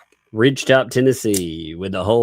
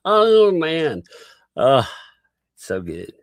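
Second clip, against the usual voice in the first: ordinary talk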